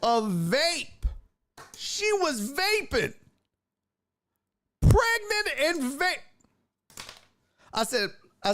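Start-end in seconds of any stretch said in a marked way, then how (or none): 1.8-6.2 can't believe what you're seeing; high-pitched scandalized squeal; shocked disbelief. Real, not acted. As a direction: voice jumps way higher, outraged and amazed. Outrage at pregnant vaping.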